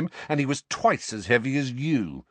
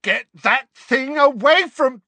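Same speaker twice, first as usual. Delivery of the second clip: very high, loud cry, cut off; terrified shout; 10 dB louder than usual. A narrator's reading. Dudley cries out at the wand, terrified. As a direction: yell in terror, breaking off.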